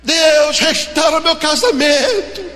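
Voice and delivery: loud, high fervent preaching; preaching at full force. Acted. Pastor exhorting the unemployed, preaching loudly.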